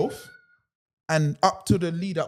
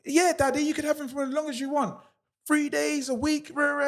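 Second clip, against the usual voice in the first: mocking the ex; 7 semitones higher than usual. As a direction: put on a high, sneering voice for her offer. Ex's words quoted again, mocking.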